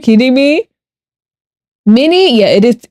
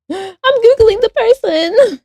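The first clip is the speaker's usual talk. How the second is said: high, slow, gasping with sudden glee; excited gasp; slower than usual. Real, not acted. Streamer excitedly deciding to Google the person. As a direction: squeal it out as the idea lands.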